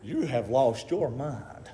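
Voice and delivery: low, slow and soft; quiet, drawn-out dismissal; hushed disbelief. Acted. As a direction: tell them quietly, word by word. You know what they said?.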